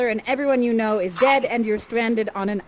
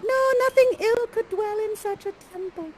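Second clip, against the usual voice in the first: high, pleading maiden; hammed-up protest, pitched way up; Miranda's voice; 10 semitones higher than usual. Miranda protesting as Ferdy is taken prisoner, high plea. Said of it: "And Miranda's like".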